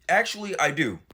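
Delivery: ordinary talk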